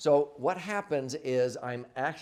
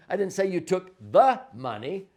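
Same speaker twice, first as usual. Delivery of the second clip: the money stressed louder, end rising, a laugh before it; 6 semitones higher, 6 dB louder than usual. Shown as a demonstration. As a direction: land hard on the money with a chuckle. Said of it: "emphasize each one of the words differently".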